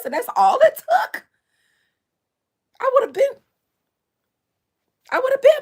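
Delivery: high, loud laughing wail; cry-laugh. Real, not acted.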